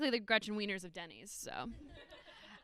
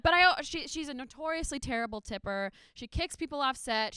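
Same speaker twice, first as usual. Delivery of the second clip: ordinary talk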